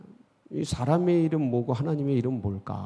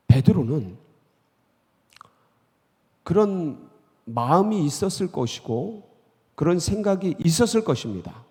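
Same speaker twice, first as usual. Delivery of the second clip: normal talking voice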